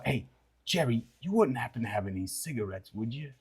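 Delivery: a lightly voiced creature, hushed and wheedling; quiet steam creature.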